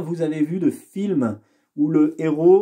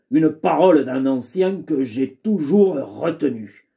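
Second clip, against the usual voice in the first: sly valet voice; 2 semitones lower than usual. Acted. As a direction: lecture like a cunning valet. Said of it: "je lui ai donné une voix un peu particulière".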